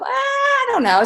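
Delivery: put-on squeaky high little girl reply. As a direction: pitch way up like a small child. Daughter's reply voiced very high.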